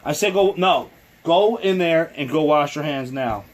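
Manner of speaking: stern, loud parental command; firm calling. Real, not acted. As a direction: insist loudly, losing patience. Parent insisting at child.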